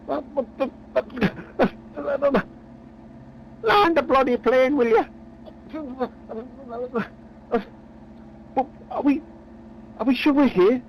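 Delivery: high, startled sputter; flustered. Acted. Phil alarmed and confused.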